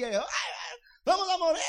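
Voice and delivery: high, crying mock wail of terror; panicked disciples. Disciples crying out in fear of drowning, high mock wail. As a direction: wail as if the boat is sinking.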